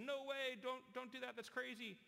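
high, alarmed protest; worried crowd. Retold or imitated. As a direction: plead with him not to try it. Doubting crowd warns performer.

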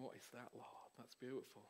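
soft, low murmur to God; hushed awe. Retold or imitated. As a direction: recall it in a hushed, wondering voice. Recalling hushed wonder at God's sound.

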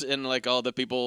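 ordinary talk

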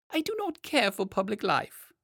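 high, prim creature voice; Manticora voice. A narrator's reading. Manticora asking the king to return to his book, high prim voice. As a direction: decline politely in a dainty creature voice. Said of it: "he begged to be allowed".

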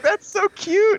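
very high mock coo. Laughing at the would-be fighter, high mock cooing. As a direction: gush in a tiny high voice. Retelling his amused reaction to fight.

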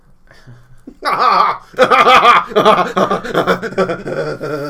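big loud burst of laughter. Real, not acted.

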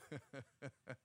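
low, very soft laugh; soft chuckle. Real, not acted. Preacher amused by his own remark, soft low chuckle. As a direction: chuckle quietly under your breath. Amused after urging people to sing.

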